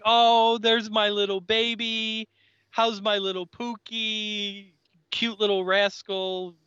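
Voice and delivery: high, gushing mock mother coo; imitating a smothering mom cooing over her baby; doting mom. Mom greeting her punk son in the school hall, high gushing coo.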